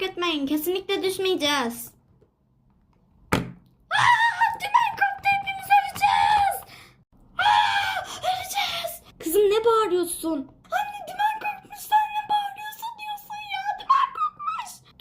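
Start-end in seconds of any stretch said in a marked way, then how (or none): 3.9-9.0 high terrified shrieks; panicked screaming. Acted. Daughter in a nightmare shrieks at the plane crashing, panicked. 10.7-15.0 high sobbing shrieks; crying panic. Acted. Daughter cries to mother that the rudder broke, sobbing panic.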